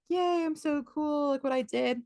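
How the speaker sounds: lightly hammed-up self-congratulation; pleased with himself. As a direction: imitate a kid proud of himself, softly singsong. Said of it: "he's, like, feeling like".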